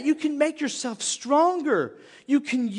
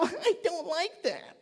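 whiny helplessness; 11 semitones higher than usual. Acted. Congregant wishing trouble away, whiny and helpless. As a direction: put on a helpless, high little voice. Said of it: "many times we go".

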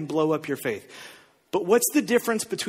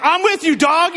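hype sidekick; 7 semitones higher, 4 dB louder than usual. Retold or imitated. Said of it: "John's just sitting next to him going".

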